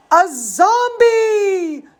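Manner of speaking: high, slow horrified cry; drawn-out spooky scream of fright. Retold or imitated. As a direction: cry out in mock horror. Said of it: "the crowd cried with horrified screams".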